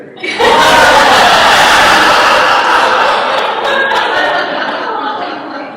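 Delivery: loud, high sustained laughter; real laughter at his dad's joke; long laugh. Real, not acted. Laughing at own story.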